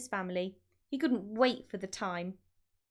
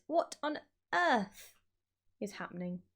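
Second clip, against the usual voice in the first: ordinary delivery, nothing marked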